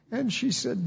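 usual speaking voice

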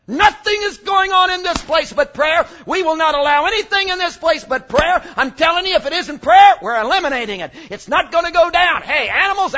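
loud, high commanding shouts; loud high commands clearing the temple; Jesus clearing temple. Retold or imitated. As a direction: drive everyone out with shouted orders. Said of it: "What was he saying?".

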